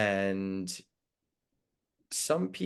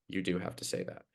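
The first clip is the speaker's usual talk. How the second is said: usual speaking voice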